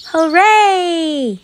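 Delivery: high, loud hooray; a child's joyful cheer; excited cheer. A narrator's reading. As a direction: cheer like a child running to school. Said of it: "said Chrysanthemum".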